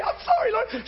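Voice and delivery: very high, squeaky mock sobbing voice; acted tearful apology in a high falsetto; imitated bawling. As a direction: wail it like a weeping wife repenting. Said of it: "she's bawling".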